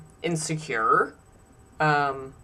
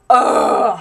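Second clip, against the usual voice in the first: disgusted groan; 6 dB louder than usual. Real, not acted.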